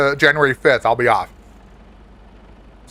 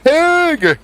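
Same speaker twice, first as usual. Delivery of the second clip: excited shout; 14 semitones higher, 4 dB louder than usual. Real, not acted. Excited greeting to viewer.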